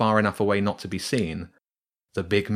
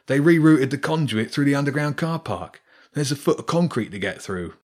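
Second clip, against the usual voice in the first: higher, grumbling rat workman; complaining rat; 5 semitones higher than usual. Acted. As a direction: moan about the concrete. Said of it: "another voice complained".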